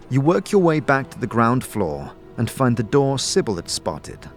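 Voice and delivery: ordinary talk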